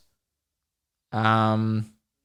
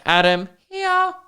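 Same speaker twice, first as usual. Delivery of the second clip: put-on much higher, slow child's reply; kid answering roll call; 3 dB louder, slower than usual. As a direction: squeak out a little kid's answer.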